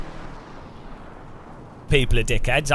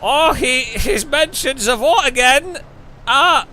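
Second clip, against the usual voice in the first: put-on silly German accent; 7 semitones higher than usual. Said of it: "he has used a silly accent".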